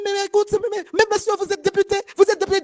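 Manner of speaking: high, stammering mock disbelief; appalled protest. French friend remonstrates with the deputy, appalled protest.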